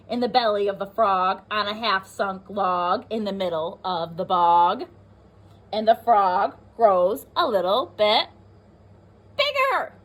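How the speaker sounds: put-on lively read-aloud for young children; teacher reading a rhyming book to the class; storybook reading. A narrator's reading.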